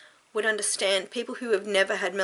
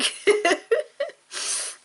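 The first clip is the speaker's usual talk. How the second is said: a sudden high, louder laugh breaking through tears; 5 dB louder than usual. Real, not acted. Telling her viewers she'd rather be a dude, tearful laughter. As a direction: laugh at herself mid-cry. Laughing at herself while crying.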